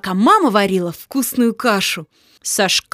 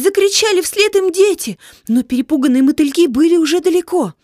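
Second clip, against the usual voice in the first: ordinary delivery, nothing marked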